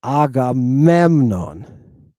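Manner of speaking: "Agamemnon" stretched out very slowly; slow classroom model of "Agamemnon"; very slow model word. Shown as a demonstration. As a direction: pronounce "Agamemnon" slowly, every syllable. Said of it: "Look at the second one".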